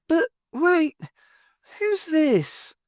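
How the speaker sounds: high, bleary, drowsy surprise; half-asleep startled question. Acted. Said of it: "Nora said blearily".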